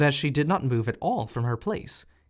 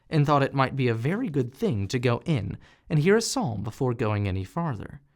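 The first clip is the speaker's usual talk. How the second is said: plain reading voice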